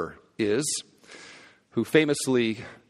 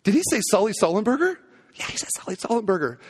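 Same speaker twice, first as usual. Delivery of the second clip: excited; 7 semitones higher, faster than usual. Retold or imitated. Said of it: "people are like".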